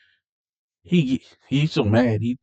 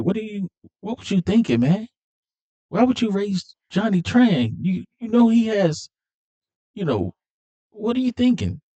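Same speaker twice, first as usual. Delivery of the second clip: exasperated scolding; 11 semitones higher than usual. Retold or imitated. Dom scolding Jesse.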